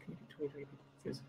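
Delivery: breathy rushed whisper of the viewer's request; quick soft whisper. Shown as a demonstration. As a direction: whisper the question fast. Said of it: "reads viewer's question again".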